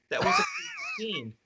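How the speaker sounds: squeaky, laughing appreciation; cracking up. Real, not acted. Cracking up over the retold scene.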